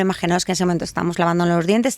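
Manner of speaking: normal talking voice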